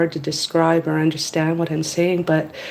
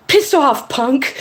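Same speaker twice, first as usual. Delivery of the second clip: high, punchy mock outburst; mock angry dismissal; 7 semitones higher, 3 dB louder, slower than usual. Woman voicing what she wishes she'd said, mock angry. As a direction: playfully tell someone to get lost. Voices what one wants to say.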